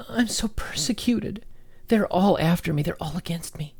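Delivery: high-pitched, put-on poor-me lament; mock persecuted victim. Commentator imitating those who blame everyone, mock-persecuted. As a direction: mimic a self-pitying victim, pitched well up. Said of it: "again, it's the".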